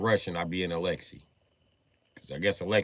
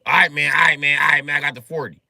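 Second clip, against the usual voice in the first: loud, higher, fed-up haggling shout; exasperated shout; 6 semitones higher, 10 dB louder than usual. Retold or imitated. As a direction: yell back at the stubborn seller. Shouting at Russian seller.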